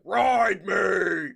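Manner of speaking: loud mock lion shout. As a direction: bellow as the lion. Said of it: "the lion that's like".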